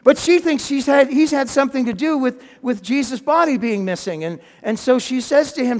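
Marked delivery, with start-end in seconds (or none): none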